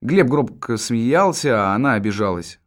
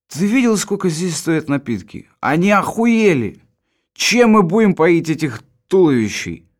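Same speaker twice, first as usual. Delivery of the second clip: indignant; 6 semitones higher, 4 dB louder, slower than usual. Acted. Indignant about drink prices.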